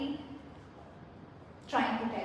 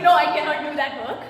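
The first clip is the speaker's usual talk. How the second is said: a sudden loud, quick "no, I cannot"; loud refusal; 2 semitones higher, 12 dB louder, faster than usual. Retold or imitated. A co-worker flatly refusing more work, loud and fast.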